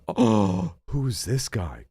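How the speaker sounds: normal talking voice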